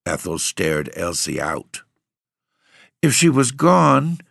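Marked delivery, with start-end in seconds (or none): 3.0-4.2 high, louder, trembling fear; quavering. A narrator's reading. Frail sister fearing the aunt is dead, quavering. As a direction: let the fear shake through. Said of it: "quavered the frailer sister".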